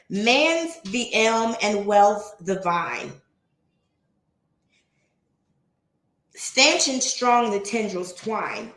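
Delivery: slow, measured poem recital; poem reading. Acted. Reading Emerson's opening poem to the stream, slow recital. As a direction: read each line slowly and deliberately. Reads the next poem aloud.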